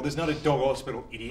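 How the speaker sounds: ordinary talk, nothing marked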